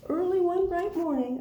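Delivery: high, loud story opening; picture-book reading. Acted. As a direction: open the tale brightly for little ones. Reads second book aloud.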